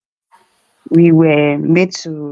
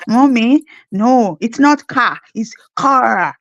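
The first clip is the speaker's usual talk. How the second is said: put-on child correcting mom, slow on "no, it's not car"; mock Canadian kid's correction of "car"; lightly voiced kid correcting mommy; kids correcting pronunciation; 3 semitones higher, 3 dB louder, slower than usual. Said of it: "correct me with the Canadian accent".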